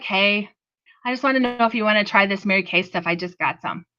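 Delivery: low, subdued, half-hearted pitch; unenthusiastic sales call. Acted. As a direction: act out a dejected caller with no energy, voice sinking. Mock unenthusiastic booking call, contrasted with excited.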